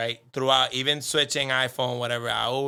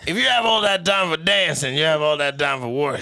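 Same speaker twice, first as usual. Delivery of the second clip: loud high scolding parent; 4 semitones higher, 7 dB louder than usual. Pretend. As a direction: lecture like a strict parent. Mocking lecturing parent.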